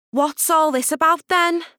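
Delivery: put-on high, loud curious girl; high-pitched, nosy schoolgirl; Minnie's question. Minnie asks in the hall.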